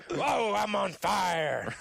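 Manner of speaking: slow, loud, high laughing taunt; game taunt voice. Retold or imitated. Gamer mimicking the shooter taunt, slow and laughing. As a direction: stretch it out like a hyped announcer. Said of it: "And then you hear".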